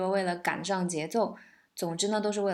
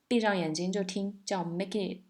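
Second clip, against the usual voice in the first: normal talking voice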